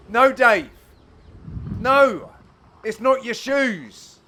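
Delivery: loud, high, drawn-out telling-off; mock scolding. Commentator telling Dave off for blaming his shoes, mock-exasperated. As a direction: scold him playfully, each phrase punched out.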